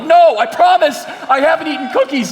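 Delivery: hammed-up pleading from the cookie thief; mock desperate denial. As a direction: squeal it like a kid caught lying. Jack denying again.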